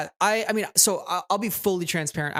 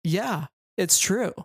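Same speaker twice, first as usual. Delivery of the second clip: ordinary talk, nothing marked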